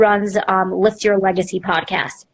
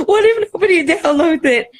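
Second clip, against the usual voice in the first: high gasping mock panic; gasp, then squeak out the worry; panicked; 10 semitones higher, 3 dB louder than usual. Scared host before her podcast launch, gasping, high and panicky.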